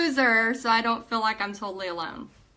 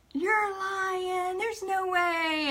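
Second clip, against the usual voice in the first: high, drawn-out mock disbelief; disbelieving; 9 semitones higher, much slower than usual. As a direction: stretch it out high as if you can't believe it. Disbelieving reply to husband.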